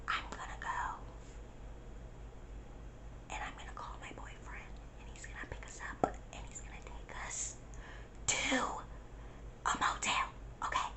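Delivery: barely audible hushed plan; ASMR-like whisper. Retold or imitated. Friend planning escape to a motel, retold whisper. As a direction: whisper it so nobody outside hears. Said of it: "it was like S M R the whole time".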